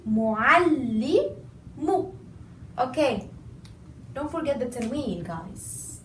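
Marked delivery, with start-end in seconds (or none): 0.1-2.1 Mu'allimu very slow with held ll; doubled lam. Shown as a demonstration. Teacher repeats the correct form slowly. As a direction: draw out mu'alli-mu. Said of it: "I stressed on the lam".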